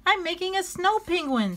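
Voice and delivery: put-on high bright kid voice; chirpy little penguin; cheerful child. Flip telling about his snow penguin, high and cheerful.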